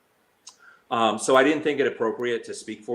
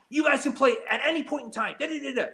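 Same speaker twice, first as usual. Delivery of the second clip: high put-on whiny voice, much higher; mocking Lori. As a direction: brush them off in a bossy high mock voice. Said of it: "She would yell at us".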